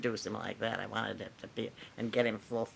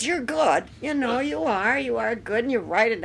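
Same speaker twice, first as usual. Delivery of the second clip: higher, louder, effusive flattery; gushing praise; 8 semitones higher, 8 dB louder than usual. Retold or imitated. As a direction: pour it on like an overeager fan.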